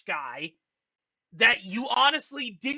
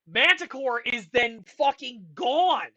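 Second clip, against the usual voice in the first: ordinary talk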